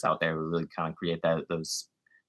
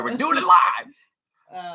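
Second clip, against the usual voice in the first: hammed-up announcer; 11 semitones higher, 6 dB louder, much slower than usual. Hyped catchphrase, live show.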